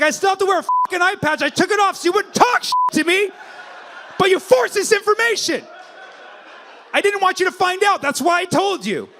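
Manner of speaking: very high, loud aggrieved yelling; hammed-up wounded outrage at full volume; mock indignant shout. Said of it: "He's like".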